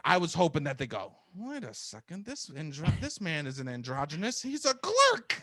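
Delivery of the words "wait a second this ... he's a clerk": from hushed puzzlement up to a drawn-out exclamation; mock amazed discovery, building; dawning realization. Imagined casters realizing he's a clerk.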